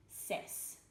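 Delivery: breathy whisper of "ses"; whispered syllable. Shown as a demonstration. Teacher modeling the syllable ses, whispered. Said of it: "in the following syllable".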